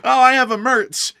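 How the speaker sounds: high, loud proud-owner voice; alien pet owner. Acted. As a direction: show off your pet to another owner. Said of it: "aliens are gonna be taking, uh, people as pets".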